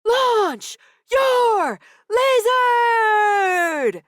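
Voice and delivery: high, slow screamed chant; crowd scream. A narrator's reading. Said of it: "The crowd screamed back".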